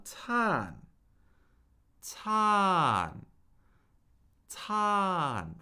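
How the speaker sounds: "tan" drawn out high, gliding down; fourth-tone "tan" in slow motion; slowed falling tone. Shown as a demonstration. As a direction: stretch "tan" through a slow steady fall. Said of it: "If I slowed it real down".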